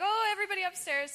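urgent retold call. Dreamer retells being herded away from a T-Rex, urgent call. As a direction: hurry everyone along, half in earnest. Retold order to flee upstairs.

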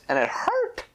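plain reading voice

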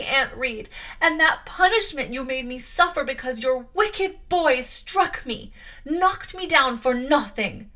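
loud, high, passionate tirade; furious accusation. Acted. Jane rages at her aunt.

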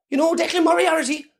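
high-pitched gossip; nosy local voice. Pretend. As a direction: gossip like a village busybody.

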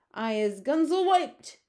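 high, loud giant shout; giant's shout. Acted. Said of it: "shouted the meat dripper".

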